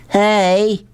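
high hailing call, no real volume; shouted call. A narrator's reading. Rob hailing Sistine as he runs, high call. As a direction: hail someone far off in a high voice. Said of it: "he shouted".

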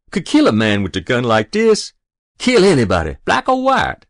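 put-on loud, rising boyish bravado; exultant boast. A narrator's reading. Boy gripping his new gun, exultant. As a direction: swagger with newfound power.

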